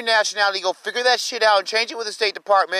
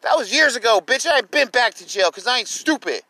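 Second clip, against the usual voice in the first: ordinary delivery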